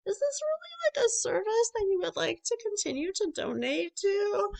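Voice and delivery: high-pitched imitation of a questioning caller; mock service caller. Mimicking a caller questioning a subscriber.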